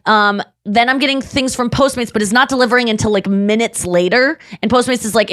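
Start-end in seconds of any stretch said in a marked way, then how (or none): none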